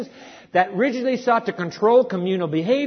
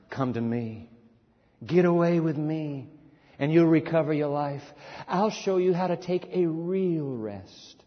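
tender scripture reading; 5 semitones lower than usual. Acted. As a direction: read it like a gentle invitation. Reads The Message aloud.

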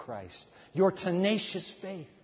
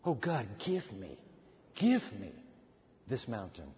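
low, soft pleading prayer; hushed low prayer; 8 semitones lower, 6 dB quieter than usual. Shown as a demonstration. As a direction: pray it quietly and low. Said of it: "ask God to give us".

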